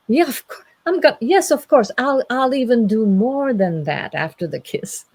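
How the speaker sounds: high, loud, stumbling eagerness; eager agreement. Acted. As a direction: jump in like an overeager bridegroom. Knight eagerly agrees to kiss.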